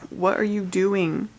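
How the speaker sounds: usual speaking voice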